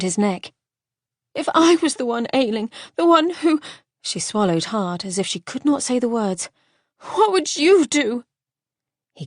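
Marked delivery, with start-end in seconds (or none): 1.3-3.6 distressed pleading. Acted. 7.0-8.2 distressed pleading. Acted.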